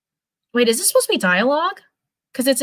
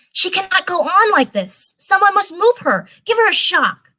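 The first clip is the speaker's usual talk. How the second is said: high put-on voice for Musa; 10 semitones higher than usual. Streamer voicing Musa, in a high put-on voice. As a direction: lift the voice up high to play Musa.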